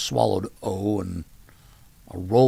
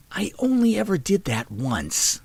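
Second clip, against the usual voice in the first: higher, slow, whispered playful retort; whispered tease; 9 semitones higher, slower than usual. A narrator's reading. Sister teasing her brother in a whisper. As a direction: tease him under your breath.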